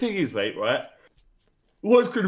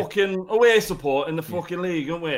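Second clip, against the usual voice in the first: put-on boastful Yorkshire fan accent, a bit higher; 4 semitones higher than usual. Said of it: "Give me a Yorkshire accent".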